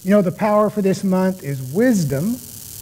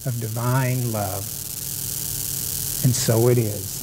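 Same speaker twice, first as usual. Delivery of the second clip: calm, slow, soft prayer voice; guided meditation; 3 semitones lower, 3 dB quieter than usual. Acted. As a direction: guide them gently into stillness. Leads the opening prayer meditation.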